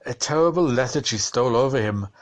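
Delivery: usual speaking voice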